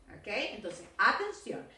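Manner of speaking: ordinary delivery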